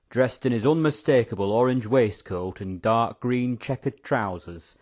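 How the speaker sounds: ordinary delivery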